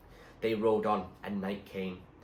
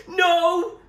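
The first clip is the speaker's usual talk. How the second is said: high, loud cry of alarm; yell; 14 dB louder than usual. A narrator's reading. Zoe sees the oar swept away, loud yell. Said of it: "yelled Zoe".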